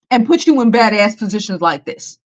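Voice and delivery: usual speaking voice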